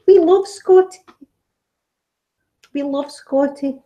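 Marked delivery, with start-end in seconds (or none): 0.1-1.0 higher and louder, eager insistence; emphatic. Real, not acted. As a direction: sell it with a bright, insistent burst. Insisting Scots love Scotty.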